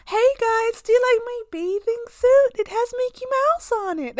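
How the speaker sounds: put-on high, loud, excited kid voice; imitated child yelling to strangers; shouting little boy. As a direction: call out proudly as a small American boy. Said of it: "he saw us and he shouted".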